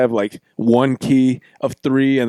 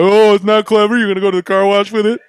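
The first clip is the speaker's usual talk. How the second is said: mocking kid taunt; 5 semitones higher, 4 dB louder than usual. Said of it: "Try telling a fourth grader".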